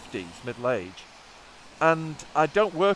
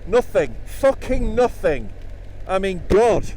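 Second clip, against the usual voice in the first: indignant outburst; 7 semitones higher, 10 dB louder, slower than usual. Real, not acted. Ignored wave, outraged.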